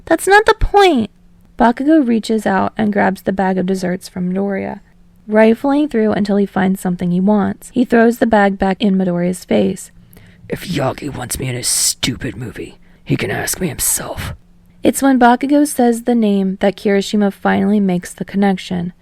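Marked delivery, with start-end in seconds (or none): 0.0-1.1 high, indignant protest; pitched-up indignant retort. Acted. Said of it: "looks indignant". 10.5-14.3 low, soft, gruff scorn; low grumbling contempt; gruff Bakugo. Acted.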